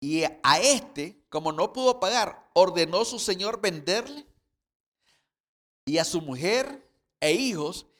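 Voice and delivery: loud, emphatic sermon reading; fervent preacher voice; forceful preaching. Acted. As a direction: preach the parable out to the congregation.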